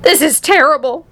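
high-pitched mother's lament; acted high wail. A narrator's reading. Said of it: "wailed Missus T V".

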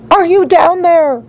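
high call into the depths; shout. A narrator's reading. Mother calls down the hole for daughter, high shout. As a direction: holler the question down in a high voice. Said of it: "shouted Missus Salt".

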